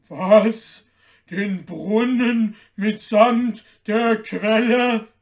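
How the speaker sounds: loud, slow giant voice, taken aback; astonished giant, heavy drawn-out words; grumbling giant. A narrator's reading. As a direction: repeat it slowly in booming disbelief. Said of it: "brummte der Riese".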